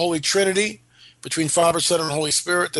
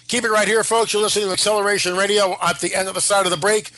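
radio announcer; 3 semitones higher than usual. Acted. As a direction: pump up the promo before the break. Radio host station promo.

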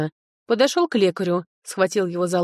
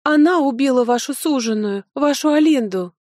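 high, heated accusation; accusing cry; 6 semitones higher, 4 dB louder than usual. A narrator's reading. Steward accuses her of killing the lord's betrothed, high accusing cry. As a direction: press the charge in a raised voice. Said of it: "вскричал управляющий".

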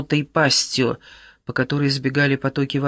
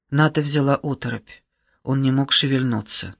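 ordinary delivery, nothing marked

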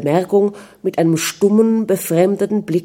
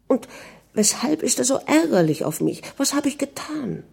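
higher and hushed, wounded; hurt inner question; 6 semitones higher, 6 dB quieter than usual. A narrator's reading. Anna wondering why he is angry, hushed hurt. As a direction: ask yourself in quiet pain.

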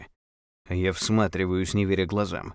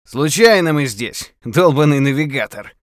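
shouting; 6 semitones higher, 9 dB louder than usual. Acted. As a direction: shout it across the clearing. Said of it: "кричу".

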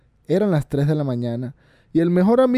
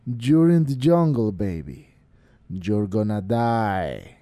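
slow, lower menacing threat; voice from beyond the grave; 6 semitones lower, much slower than usual. A narrator's reading. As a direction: sink low and slow. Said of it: "el viejo lo cortó y le dijo".

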